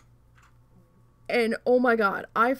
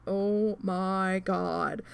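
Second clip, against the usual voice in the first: exasperated; 3 semitones lower, much slower than usual. Retold or imitated. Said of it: "and I was just like".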